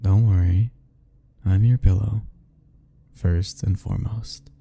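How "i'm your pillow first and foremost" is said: ordinary delivery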